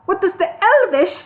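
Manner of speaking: put-on high, loud yell in an accent. A narrator's reading. Bingo yelling into the deaf wizard's ear. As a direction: shout it straight in his ear. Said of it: "yelled Bingo directly into the wizard's ear".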